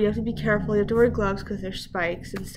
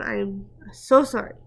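ordinary delivery

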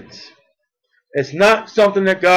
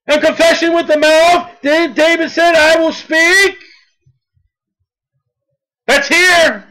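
full-force preaching; 6 semitones higher than usual. Acted. Pastor urging the congregation to confess Christ, full-force preaching.

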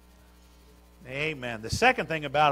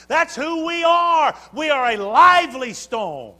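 louder preacher's rising cadence; full-force preaching; 7 semitones higher, 9 dB louder than usual. Acted. Preacher urging the church to be lively, full-force preaching. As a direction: drive the point home to the pews.

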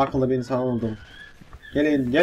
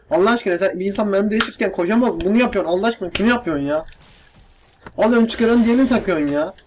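louder, higher, fast complaint; indignant rant; 5 semitones higher, 4 dB louder, faster than usual. Real, not acted. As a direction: rant at the unfair reload. Ranting at the game mechanics.

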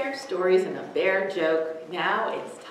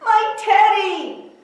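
much higher, louder slow cry; cried out; 10 dB louder, slower than usual. Acted. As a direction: cry out for a lost teddy. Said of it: "cried Eddie".

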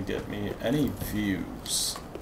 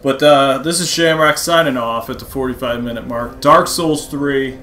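sign-off outro; 4 semitones higher, 10 dB louder than usual. Real, not acted.

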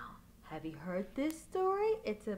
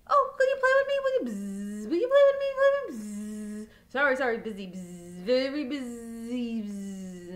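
a bee too busy to stop, buzzing off; 3 semitones lower, 9 dB louder than usual. Acted. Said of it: "the bee's going really fast".

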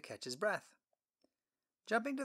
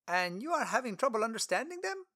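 higher, louder friendly traveler; Philip voice; 8 semitones higher, 4 dB louder than usual. Acted. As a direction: introduce yourself warmly.

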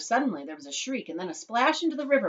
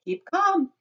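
high, raised-voice reassurance; cried out; 14 semitones higher, 6 dB louder than usual. A narrator's reading. Chocolate maker cries to panicking mother, urging calm. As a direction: call out to soothe. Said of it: "cried Mister Wonka".

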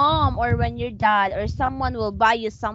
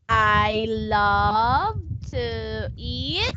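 put-on slow, spelled-out model phrase for a child to copy; slow model sentence; 2 semitones lower, much slower than usual. Shown as a demonstration. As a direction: stretch "I love to eat." out word by word.

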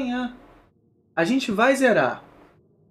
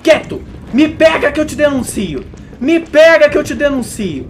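loud, high shouted threat; yelling at enemy; 7 semitones higher, 7 dB louder than usual. Real, not acted. (grito alto e irritado)

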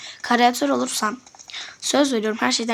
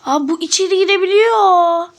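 high, loud surprised outburst; 7 semitones higher, 8 dB louder than usual. Real, not acted. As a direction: burst out in surprise. Surprised discovery in game.

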